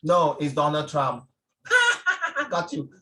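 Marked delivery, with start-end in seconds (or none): 1.6-3.0 laughing. Real, not acted. Teacher laughs at his joke.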